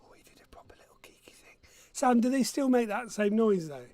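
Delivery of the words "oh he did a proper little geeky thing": fast whispered aside. Real, not acted. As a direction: whisper it quickly behind his back. Quiet aside about son.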